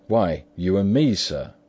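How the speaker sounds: undaunted. A narrator's reading. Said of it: "was the undaunted reply".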